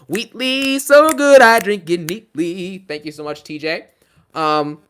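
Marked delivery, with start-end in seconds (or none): 0.1-2.8 jingle read. Pretend. Reading rhyming donation as jingle.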